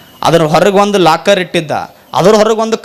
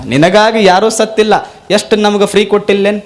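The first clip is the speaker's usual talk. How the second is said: ordinary talk, nothing marked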